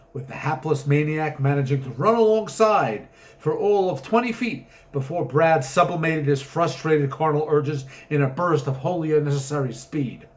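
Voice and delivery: plain reading voice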